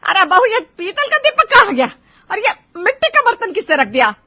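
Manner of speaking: high, loud outraged shouting; an incensed mother-in-law demanding answers; गुस्सा. A narrator's reading. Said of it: "गुस्सा होकर कहती है". (ग़ुस्से में चीखती सास)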